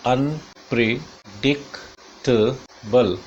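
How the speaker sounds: unpredictable very slowly, five separate beats; slow teaching breakdown of "unpredictable"; syllable by syllable. Shown as a demonstration. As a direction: break "unpredictable" into un, pre, dic, tuh, bul with pauses. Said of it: "ये five syllable हुए".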